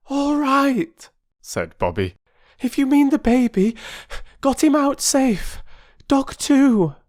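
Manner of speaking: put-on high, loud girl reassuring; girl telling the mother her baby got out; girl's voice. A narrator's reading. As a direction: tell her the baby is safe.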